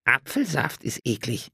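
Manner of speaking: put-on high child voice, a touch disgusted; sulky little boy complaining; whiny boy. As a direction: nephews complaining to their uncle about the juice. Boys complain about juice.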